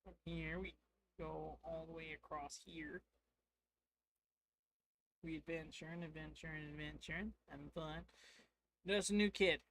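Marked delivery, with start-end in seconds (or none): none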